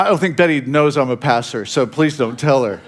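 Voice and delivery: normal talking voice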